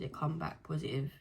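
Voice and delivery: ordinary talk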